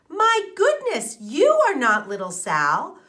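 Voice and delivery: frightened mother calling out; alarmed gasp. A narrator's reading. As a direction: cry out for a lost child in alarm.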